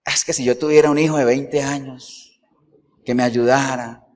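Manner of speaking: sarcastic complaint. Retold or imitated. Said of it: "Con sarcasmo".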